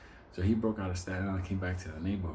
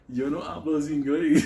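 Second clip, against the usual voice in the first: louder, laughing fake Spanish; laughing mock Spanish; 6 semitones higher, 8 dB louder than usual. Benny pretending to the prosecutor he speaks no English, laughing. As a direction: play dumb through a laugh.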